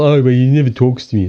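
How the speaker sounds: a touch of a whiny complainer; mimicking whiny complaint. Imitating a woman complaining her man never talks, mild whine. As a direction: whinge it, mildly mocking. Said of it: "this is really annoys me".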